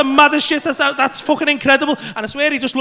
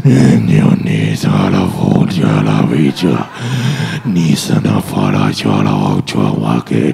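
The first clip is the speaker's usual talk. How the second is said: demon voice; slower than usual. Retold or imitated. Comedian as his possessed friend pointing at him, deep demon voice. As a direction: growl slow gibberish like the possessed. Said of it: "Demon voice is gonna eat me".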